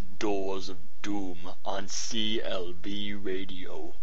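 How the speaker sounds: low, slow welcome-back read; low, unhurried announcer voice; radio announcer. Acted. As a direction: ease the audience back into the story.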